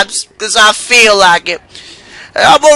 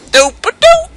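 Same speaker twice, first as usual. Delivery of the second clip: very high nonsense ditty; silly falsetto. Pretend. Player fooling around mid-game, silly falsetto tootling. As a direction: toot the syllables way up high.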